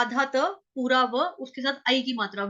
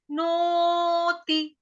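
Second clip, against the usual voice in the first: Niti with the first vowel held very long, higher; 4 semitones higher, much slower than usual. Shown as a demonstration. Teacher demonstrates the long vowel, Niti chanted with a drawn-out O. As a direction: chant Niti, stretching the O, short final i. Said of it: "तो दीर्घ मात्रा है ओ".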